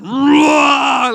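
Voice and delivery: huge, loud, booming roar; full-throated lion bellow; mighty lion roar. Retold or imitated. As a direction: roar like the father lion behind the cub. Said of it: "as he roars".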